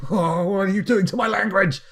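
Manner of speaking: loud, high hammed-up outcry; mock anguish. English speaker hearing a mangled accent, mock anguish. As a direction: cry out in mock horror. Imagined pained listener to bad accent.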